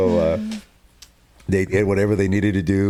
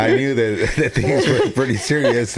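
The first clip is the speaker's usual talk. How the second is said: laughing through the words, a little higher; 4 semitones higher than usual. Real, not acted. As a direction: chuckle while recalling it. Husband laughing while retelling.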